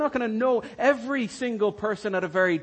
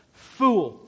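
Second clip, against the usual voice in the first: "Fool" said louder as a harsh judgement; stern verdict; 7 dB louder than usual. Pretend. A stern, louder "Fool" as the imagined harsh judge. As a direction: Christ pictured condemning a believer as "Fool", louder, stern.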